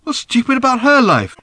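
high, loud demand; insisting. A narrator's reading. Arthur insisting to Random, high and loud. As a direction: insist on an answer. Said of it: "insisted Arthur".

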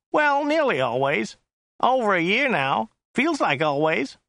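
high, whiny chauffeur; Joe's whiny voice. A narrator's reading. Driver describing the client to his passenger, high and whiny. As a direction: pitch it up into a whine. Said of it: "His voice was rather high and whiny".

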